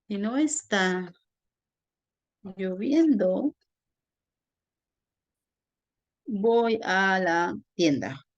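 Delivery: slow phrases with long gaps; slow dictation. Shown as a demonstration. Teacher writing a conditional example for students, slow dictation. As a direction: dictate it bit by bit, stretching words.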